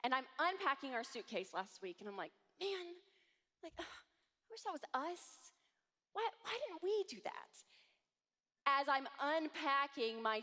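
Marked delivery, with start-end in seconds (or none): 2.6-7.5 high, soft, envious sigh; wistful longing. Retold or imitated. Mom unpacking, envying friend's princess breakfast, softly wistful. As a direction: pine for what the friend had.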